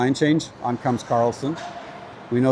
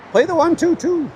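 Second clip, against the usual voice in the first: high-pitched imitation of the coach barking a system; mock Coach Dave; 14 semitones higher than usual. Teasing impression of the coach calling the one two two, high mock bark. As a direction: lampoon the coach calling the play, far higher. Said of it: "Coach Dave is gonna be saying".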